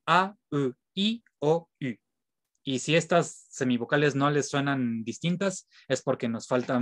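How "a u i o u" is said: French vowels said one by one; careful French vowel drill; French vowel demo. Shown as a demonstration. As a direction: sound out each French vowel clearly.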